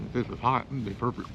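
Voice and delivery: talking with a mouth full of food. Real, not acted. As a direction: chew and talk through the food. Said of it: "not to eat with my mouth full".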